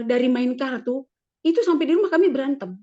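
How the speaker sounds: normal talking voice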